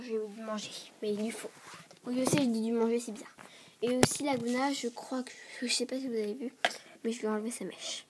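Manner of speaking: usual speaking voice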